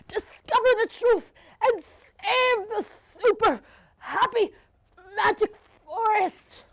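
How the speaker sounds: high desperate cry; shouting. Acted. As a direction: call after him in alarm, high. Blossom cries out as friend taken.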